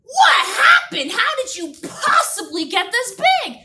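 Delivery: high, loud astonished shout; shocked cry. Retold or imitated. Miss Irby shouting in shock at giant Herman. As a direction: shout in disbelief at the huge worm. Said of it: "I'm like".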